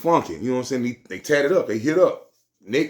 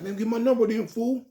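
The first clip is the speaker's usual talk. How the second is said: higher clerk voice; voicing the clerk; 12 semitones higher than usual. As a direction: ask for the number, pitched up.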